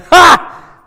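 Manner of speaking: gloating shout. Retold or imitated. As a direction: crow over the beaten brother, hammed up. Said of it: "Luke is over top of him going".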